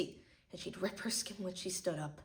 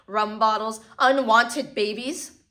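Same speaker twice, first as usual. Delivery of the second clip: louder, slow bitter emphasis; bitter outrage; 8 dB louder, slower than usual. Acted. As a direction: spit out each word. Bitter list of squalor.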